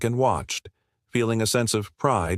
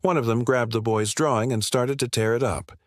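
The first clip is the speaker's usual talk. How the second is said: plain reading voice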